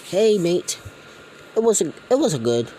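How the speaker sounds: high, loud and fast, mock friendly; Australian gang boss. Gang boss greets the dad, high and loud. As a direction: rattle it off like a smug boss. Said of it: "this game's Australian".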